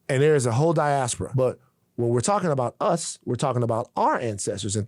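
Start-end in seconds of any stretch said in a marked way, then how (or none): none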